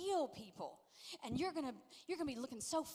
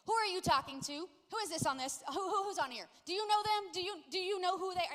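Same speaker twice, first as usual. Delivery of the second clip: louder, higher rapid-fire interrogation; exaggerated suspicious mother, pitched up; nagging mom impression; 6 semitones higher, 6 dB louder than usual. Mom grilling her son about online friends, exaggerated. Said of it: "he says that I sound like this".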